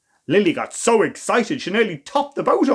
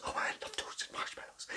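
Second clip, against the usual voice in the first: whispered aside; 22 dB quieter than usual. Real, not acted. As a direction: breathe it like a little confession. Narrator's own whispered aside.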